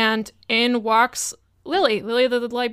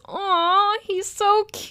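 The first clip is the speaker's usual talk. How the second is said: higher, cooing over a cute pet; Lily cooing over the fairy familiar, pitched up; 9 semitones higher, slower than usual. Acted. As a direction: melt at the little sprite. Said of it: "And Lily goes".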